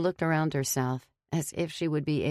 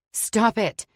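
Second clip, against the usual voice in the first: higher, louder snap; angry shout; 8 semitones higher, 5 dB louder than usual. A narrator's reading. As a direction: bark it at him while wrestling. Struggling with kicking husband.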